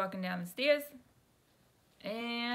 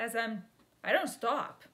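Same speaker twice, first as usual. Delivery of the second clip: ordinary talk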